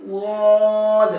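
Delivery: غاد heavy, from the side of the tongue, drawn out; full-mouthed daad. Shown as a demonstration. Teacher showing students the side-tongue غاد. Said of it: "منہ بھر کے پڑھیں گے".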